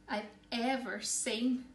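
ordinary delivery, nothing marked

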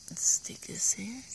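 slow whisper. Real, not acted. Gardener setting the camera down, whispering slowly. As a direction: slowly whisper it while working.

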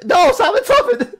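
high, loud burst; high loud amused exclamation; excited outburst. Real, not acted. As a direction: blurt it out in disbelief. Reads a silly name loudly.

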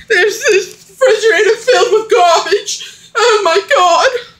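frantic, laughing and near tears. Acted. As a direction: spiral into a frantic panic. Panic over hidden cameras.

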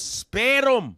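loud, high Scottish "Sperm", rolled R as "sperrum"; Scottish accent. Acted. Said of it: "He goes".

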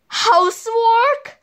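high, incredulous question; dismayed surprise. Acted. Bad boys reacting to the chore list, incredulous. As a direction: shoot the pitch right up in disbelief.

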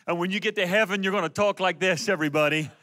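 usual speaking voice

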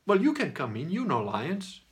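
high, slow, casual invitation; mildly imitated easygoing zookeeper; friend's reply. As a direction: voice a relaxed friend waving you in. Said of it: "he goes".

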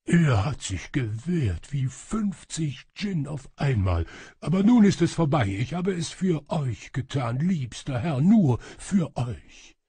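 exhausted but fervent devotion; weary, devoted shadow servant. A narrator's reading. Shadow servant tells master of his struggle, weary fervour.

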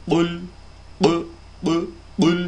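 exaggerated bounce on Kul; mocking the overdone recitation of Kul; overdone qalqala. Shown as a demonstration. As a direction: Kul said with a heavy exaggerated echo on the l. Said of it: "Bu kadar abartmanın manası yok".

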